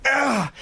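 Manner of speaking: high, strained swinging "ah"; mimed effort groan. Retold or imitated. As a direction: grunt as if pumping a swing with your legs. Mimics pumping legs on the swing.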